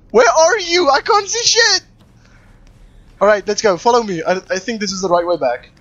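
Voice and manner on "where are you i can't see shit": high panicked call; frantic, lost in the dark. Real, not acted.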